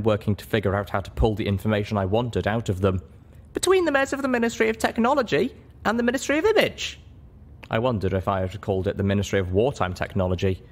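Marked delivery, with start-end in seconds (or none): 3.5-7.0 put-on much higher, lighter young mare voice; a high young pony heroine choosing her words; heroine's voice. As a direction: speak up as the small, earnest heroine.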